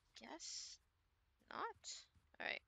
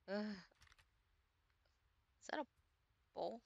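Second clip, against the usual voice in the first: ordinary talk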